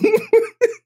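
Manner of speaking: helpless high-pitched laughter; high wheezing laugh. Real, not acted. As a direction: burst out laughing, can't stop. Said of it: "Oh, I'm crying right now".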